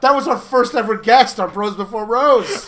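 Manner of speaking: loud, laughing burst of excitement; excited announcement. Real, not acted. Host celebrating the show's first guest, excited announcement. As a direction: proclaim it loudly through laughter.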